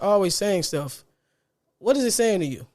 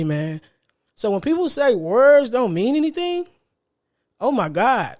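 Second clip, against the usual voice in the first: ordinary talk, nothing marked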